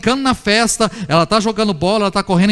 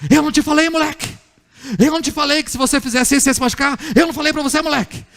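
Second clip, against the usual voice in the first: higher-pitched telling-off, imitating dad; scolding father; 6 semitones higher, faster than usual. Father telling off a boy who got hurt, higher-pitched scolding. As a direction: scold the child like an exasperated father. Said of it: "Como é que o pai trata?".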